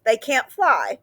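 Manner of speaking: ordinary delivery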